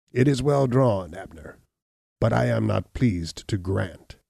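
lightly voiced hunchback reading a deed at leisure; unmoved, sardonic Gall. A narrator's reading. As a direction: weigh up the legal terms, then coolly refuse.

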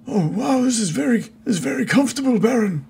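high, lightly voiced nobleman; NPC voice. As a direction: voice the wizard cheerfully pleased. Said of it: "Exothantor is like".

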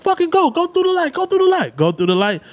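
high, pleading and urgent; imitating panicked teens in the car; frantic urging. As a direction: beg the driver to run the light. Urging through red light.

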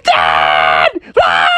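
drawn-out mock terror scream; screaming Froggy. Froggy hears wolf breath, screams in terror. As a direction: scream for your father in hammed-up terror. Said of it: "And Froggy's like".

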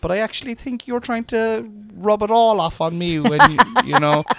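high, loud laughing banter; laughing teasing. Real, not acted. Teasing his wife about the bedroom, laughing loud and high. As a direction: tease her through the laughter.

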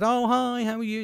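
high-pitched sing-out hello, imitating himself; exaggeratedly high, friendly hello; chirpy greeting. Acts out his greeting to the barmaid.

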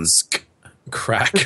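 "Ask Crack" with heavy emphasis on "Crack"; emphatic. Real, not acted. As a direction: pause after "Ask", then punch out "Crack". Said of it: "put a lot of emphasis".

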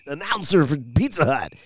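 put-on cartoonish voice-guy pitch; exaggerated pizza ad voice-over; mock announcer voice. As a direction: imitate a hammy commercial announcer. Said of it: "didn't like the fact that he was doing the".

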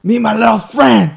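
loud, high, bad Cuban-American accent; hammed-up Scarface accent; terrible Scarface accent. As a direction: bellow it in a bad Tony Montana voice. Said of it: "That was a terrible accent".

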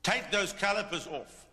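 surprised at your own command; blurted command. Retold or imitated. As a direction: let it burst out before you think. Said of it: "I hear myself say".

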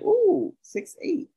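high breathy ooh, six eight; mock-impressed coo. Teasing her friend about his height, high cooing.

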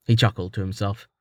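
plain reading voice

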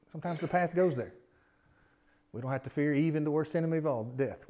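usual speaking voice

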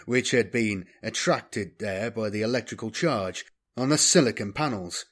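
plain reading voice

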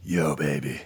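low, soft come-on; mock seduction. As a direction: murmur it low like a hammy seducer.

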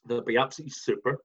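ordinary talk

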